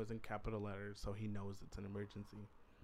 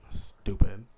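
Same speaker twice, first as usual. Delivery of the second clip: self-scolding; 2 semitones lower, 17 dB louder than usual. Real, not acted. Scolding himself for giving number.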